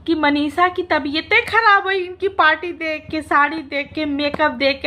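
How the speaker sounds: ordinary talk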